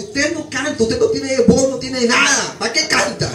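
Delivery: high, loud scornful put-down with a laugh; mock the singer in front of everyone; scolding pastor. Pastor scolding the off-key worship leader, loud and scornful. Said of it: "me bajaba y me decía".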